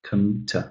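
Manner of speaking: short, quiet, low "com-, t-"; reduced "com-, t-", barely voiced; unstressed syllables. Shown as a demonstration. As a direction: toss off "com-" and "t-" as weak beats. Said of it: "these are pretty short".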